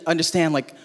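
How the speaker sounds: usual speaking voice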